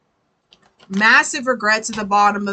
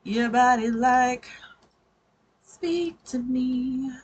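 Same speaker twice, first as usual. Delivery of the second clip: slow crooning voice, a bit higher; odd put-on voice; 3 semitones higher, slower than usual. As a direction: repeat the strange seductive croon. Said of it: "what that accent was".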